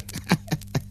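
high, quick giggling cackle; squeaky mocking laugh; gull's cackle. A narrator's reading. Gull laughs at the stork, high cackle. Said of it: "chechtal se dál Racek".

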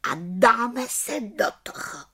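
high, contented little witch voice; ready-to-go little witch; satisfied little witch. Acted. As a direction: announce it high and pleased. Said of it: "spokojeně zvolala malá čarodějnice".

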